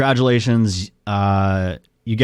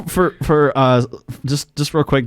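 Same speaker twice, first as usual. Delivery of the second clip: ordinary talk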